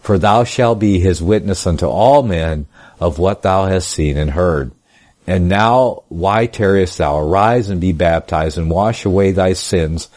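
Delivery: ordinary delivery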